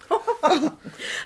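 short, high laugh; amused laugh. Acted. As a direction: brush off the alarm with a laugh. Amused by Dora's alarm.

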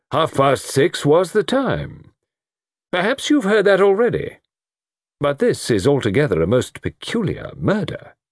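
pointed colonel. Acted. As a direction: press the point with deliberate emphasis.

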